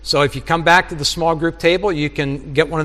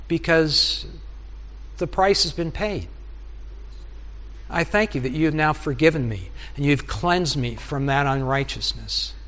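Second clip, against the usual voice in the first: soft, humble spoken prayer; a husband confessing to God, softly; quiet prayer; 3 semitones lower, 6 dB quieter than usual. Acted. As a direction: pray it gently and contritely.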